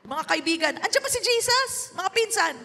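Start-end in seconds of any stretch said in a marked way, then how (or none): 0.8-2.0 asking around. Retold or imitated. Asking friends about missing Jesus.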